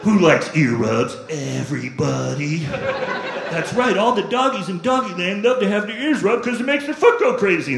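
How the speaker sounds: high, cooing pet talk; doting dog-owner baby voice. Retold or imitated. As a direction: gush over the dog like a puppy. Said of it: "I'm like".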